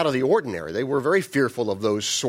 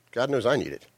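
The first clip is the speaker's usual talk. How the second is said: ordinary talk, nothing marked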